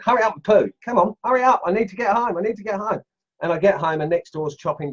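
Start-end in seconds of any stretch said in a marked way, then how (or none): 0.0-3.0 urging the dog. Retold or imitated. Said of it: "I'm walking along with the dog and I'm going".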